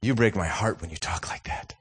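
hushed, whispery wounded dad; mock-tragic half-whisper to a child; acted heartbreak. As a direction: softly whisper it as if deeply hurt. Acted hurt reply to son.

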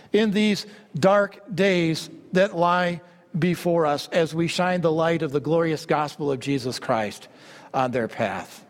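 ordinary talk

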